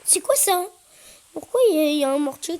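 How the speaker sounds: loud surprised question; baffled outcry. Real, not acted. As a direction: demand what that is. Confused in game.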